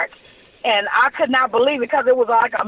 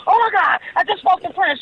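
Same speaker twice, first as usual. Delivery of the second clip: high, breathless with excitement; excited gush; 6 semitones higher than usual. Retold or imitated. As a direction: blurt the news out, thrilled. Calling mother, thrilled news.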